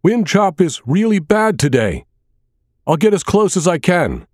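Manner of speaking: high, loud call over the engine noise; yelling. A narrator's reading. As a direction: shout it across the boat against the wind. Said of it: "She yelled in my direction".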